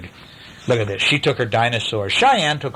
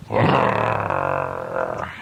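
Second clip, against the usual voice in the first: put-on low angry boy's growl. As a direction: growl at your sister. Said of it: "Hunter went".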